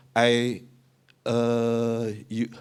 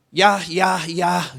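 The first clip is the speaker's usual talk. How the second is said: put-on high, loud call of a child; 7 semitones higher, 8 dB louder, much slower than usual. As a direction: call across the house like a kid. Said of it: "kalau anakku manggil aku gini". (anak memanggil ayah dengan keras)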